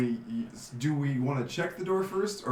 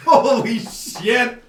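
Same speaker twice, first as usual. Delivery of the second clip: laughing; 10 semitones higher, 10 dB louder, much slower than usual. Real, not acted. Nineteen rolled with advantage.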